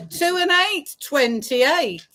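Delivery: sing-song bingo number calls; drawn-out call then quick repeat; bingo caller. Acted.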